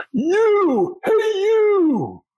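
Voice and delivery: very high, drawn-out calling voice; imitated yell. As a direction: holler it high and long, imitating a stranger across a crowd. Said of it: "who they're yelling at".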